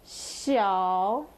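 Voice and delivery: "xiao" dipping low, then rising; third tone. Shown as a demonstration. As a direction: draw "xiao" down and back up. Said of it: "third accent".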